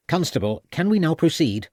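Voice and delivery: a touch of impatience pushing the constable on; mildly irritated, clipped request. A narrator's reading. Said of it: "in an irritated voice".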